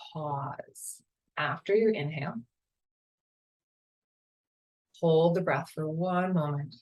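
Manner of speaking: calm, unhurried meditation guide voice; guided meditation. Acted.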